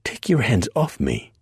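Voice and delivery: put-on a high indignant snap, Southern accent not needed. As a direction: sister snaps at a stranger's grabbing hands, high-pitched. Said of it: "Sister Amelia snapped".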